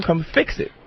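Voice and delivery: ordinary talk, nothing marked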